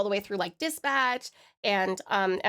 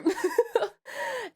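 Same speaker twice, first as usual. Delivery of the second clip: high laughing fit; 13 semitones higher than usual. Real, not acted. Laughing fit after loopy remark.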